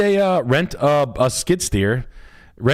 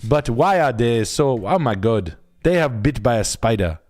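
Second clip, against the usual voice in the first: a Nigerian priest drawling Jesus Lord, hammed up; Nigerian teacher impression; 2 semitones higher, slower than usual. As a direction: act out his old teacher scolding him with wonder. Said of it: "He was like".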